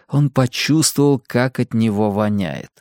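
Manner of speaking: ordinary delivery, nothing marked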